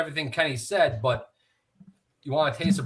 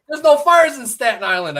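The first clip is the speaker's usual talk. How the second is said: mock yell, higher and louder; retold yelling; 10 semitones higher, 8 dB louder than usual. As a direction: bark it as the veteran mocking Staten Island. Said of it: "he's fucking yelling at me".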